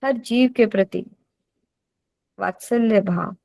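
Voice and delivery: usual speaking voice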